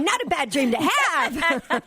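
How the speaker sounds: slow, giggling aside; teasing, laughing drawl; laughing quip. Retold or imitated. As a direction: chuckle through a cheeky joke. Joking reply to husband.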